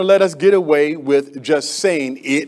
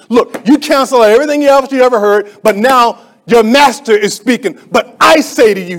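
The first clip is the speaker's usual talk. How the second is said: louder, commanding declaration; with authority; 5 semitones higher, 9 dB louder than usual. Retold or imitated. Preacher voicing Jesus commanding his listeners. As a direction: speak as the master, with full authority. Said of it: "he says it with authority".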